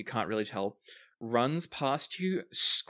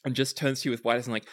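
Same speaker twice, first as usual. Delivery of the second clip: normal talking voice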